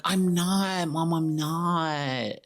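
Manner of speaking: put-on slow, dragged-out childish whine; imitated whiny kid. Kid reassuring doubtful mom, slow mock whine. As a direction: drag the words out like a pestering kid.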